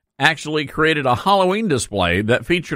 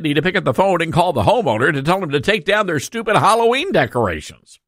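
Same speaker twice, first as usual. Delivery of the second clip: ordinary talk, nothing marked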